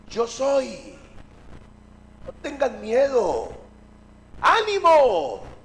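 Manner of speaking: loud, drawn-out call building to a shout. Acted. Jesus calls to frightened disciples across the water, loud calling out. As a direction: project it slowly across open water.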